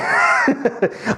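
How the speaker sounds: high, sudden shout of a laugh; real high laugh at the disciples; burst of laughter. Real, not acted.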